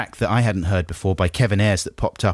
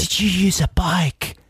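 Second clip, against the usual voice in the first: whisper; 8 semitones higher, slower than usual. Acted. Mock whispered question.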